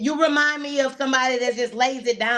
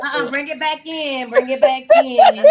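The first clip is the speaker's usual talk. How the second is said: imitating Brenda; 2 semitones higher, 7 dB louder than usual. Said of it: "She be like".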